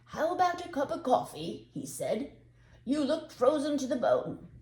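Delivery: high, loud and friendly; hearty offer. Acted. Shoemaker inviting the cold road sweeper in, hearty and loud. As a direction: offer it warmly to a freezing man.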